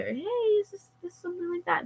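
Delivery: high, soft put-on voice; tentative imagined query. Office guy supposedly checking with mom, tentative high query.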